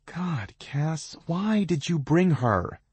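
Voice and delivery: lightly voiced pained whine; pained drone. A narrator's reading. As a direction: drag it out like it hurts. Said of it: "one voice drones as if she's in pain".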